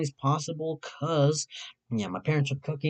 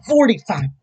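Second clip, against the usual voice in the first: gasping surprise; 8 semitones higher, 8 dB louder than usual. Real, not acted. Streamer rechecking his channel, gasping surprise. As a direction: gasp then a loud, high word. Gasp at count.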